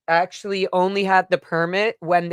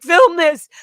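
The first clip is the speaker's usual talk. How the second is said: urgent command; 10 semitones higher, 7 dB louder than usual. Retold or imitated. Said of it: "And then I'm like".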